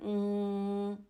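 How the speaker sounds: nasal hum held steadily on the m; غنة. Shown as a demonstration. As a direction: hum a long mmm through the nose. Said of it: "يطلع الصوت من الخيشوم". (غنة ممدودة من الخيشوم)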